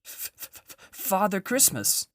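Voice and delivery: frightened fox stammering the name. A narrator's reading. Terrified fox answering the witch, fearful stammer. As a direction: stutter out the first sound in fear. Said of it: "stammered the fox".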